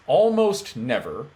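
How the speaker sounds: "almost never" drawn out slowly, "almost" punched; stress on almost. Real, not acted. Coach offering the rare exception, slow emphatic "almost never". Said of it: "notice I'm saying almost".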